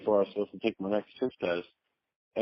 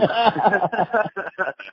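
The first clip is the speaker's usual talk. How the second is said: high laugh; 10 semitones higher than usual. Real, not acted. Laughs after telling the lie.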